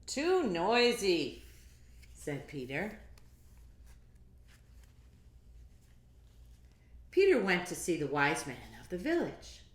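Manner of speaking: put-on lively read-aloud to kids; picture book reading. A narrator's reading. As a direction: read it big and bouncy for children.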